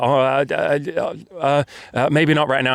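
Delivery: imitated flustered stammer, drawn out and halting; flustered spluttering. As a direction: splutter through the hesitation, mimicking someone caught off guard. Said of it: "she kind of spluttered out".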